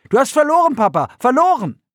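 put-on high, loud childish teasing; gloating boy. A narrator's reading. Boy teases his father about losing the race, gleeful. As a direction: rub it in like a triumphant kid.

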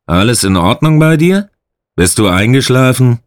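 calling through a door. A narrator's reading. Guard calls through toilet door.

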